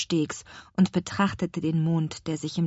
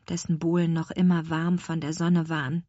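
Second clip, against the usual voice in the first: ordinary delivery, nothing marked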